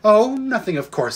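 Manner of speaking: dripping with irony; sarcastic. A narrator's reading. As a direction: start high and airy, then drop away, sarcastically. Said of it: "said Polly sarcastically".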